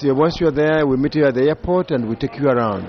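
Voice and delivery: ordinary delivery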